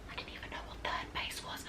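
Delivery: whispered confession, unvoiced. Real, not acted. Confessing to viewers in a hushed whisper. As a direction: let the secret slip in a whisper.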